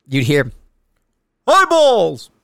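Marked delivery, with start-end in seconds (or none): 1.5-2.3 high, loud drawn-out call; imitated warning yell. Recruit warning the drill instructors someone is coming, imitated yell. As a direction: yell it like a lookout sounding the alarm. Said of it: "they're yelling eyeballs".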